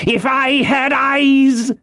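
high, long-drawn frustrated wail; anguished cry. A narrator's reading. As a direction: stretch it out in a high shout. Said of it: "he cried".

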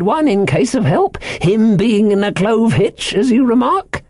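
high, slow, wheedling sailor; eccentric castaway, coaxing. A narrator's reading. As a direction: coax him in a high castaway voice. Ben Gunn's voice.

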